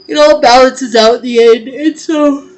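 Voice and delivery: yawning through words. Real, not acted. A tarot reader yawning mid-reading to viewers, words stretched by a yawn. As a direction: let a yawn swell through the line. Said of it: "I don't know why I keep yawning".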